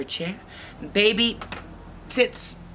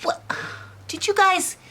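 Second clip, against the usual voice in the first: high, startled waking question; high-pitched puzzlement; puzzled Goldilocks; 14 semitones higher than usual. Acted. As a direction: ask as if woken by a noise. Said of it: "she gets up and she's like".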